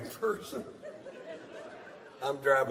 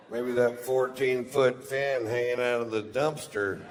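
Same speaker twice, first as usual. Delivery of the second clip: ordinary delivery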